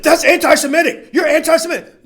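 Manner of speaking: high, loud indignant outburst; mock the knee-jerk accusation; mock accusing shout. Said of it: "they'll be like".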